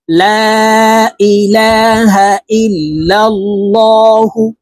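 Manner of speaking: slow chanted ইল্লাল্লাহু with a heavy, thick L; thick lam. Shown as a demonstration. Teacher showing how Allah's name is read in ইল্লাল্লাহু, thick lam. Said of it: "এই লামকে ল অর্থাৎ মোটা করে পড়ব".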